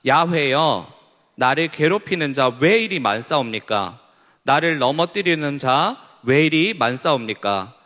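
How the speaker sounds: a loud, solemn psalm reading; scripture reading aloud. Acted. Pastor leading youth in reading the psalm, loud solemn recitation. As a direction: read the lament out to the congregation.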